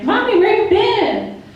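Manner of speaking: put-on high, slow little kid asking; a small child asking for mommy; child's voice. Daughters greeting mom returning home, high child voice. Kids voiced in a standup bit.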